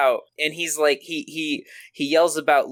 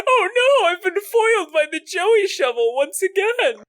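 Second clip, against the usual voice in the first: ghost voice; 14 semitones higher than usual. Acted. Pegasus foiled, ghostly wail.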